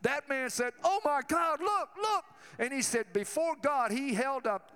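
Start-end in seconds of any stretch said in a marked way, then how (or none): 0.8-2.2 high, loud cry of wonder; amazed cry. Retold or imitated. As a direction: shout it out like a miracle just happened. Healed man crying out in awe.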